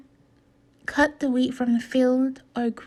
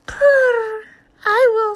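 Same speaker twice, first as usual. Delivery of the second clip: high, loud cat voice with a purr; 11 semitones higher, 9 dB louder, slower than usual. A narrator's reading. Greedy cat wanting bread, high loud purr. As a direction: purr then chirp it eagerly. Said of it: "smelled the cat".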